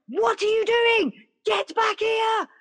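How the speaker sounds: very high, drawn-out yell; high-pitched retold scream to come back; imitated panicked shout. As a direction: act out shouting in alarm from a riverbank, each word stretched. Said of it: "Like this".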